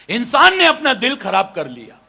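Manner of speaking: ordinary talk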